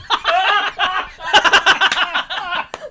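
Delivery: high, drawn-out laugh; long laugh. Acted. Arts reporter teasing the literal-minded host, long high laugh.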